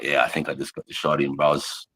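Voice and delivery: low, soft, nonchalant reply; casual understatement. Retold or imitated. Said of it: "I'm like".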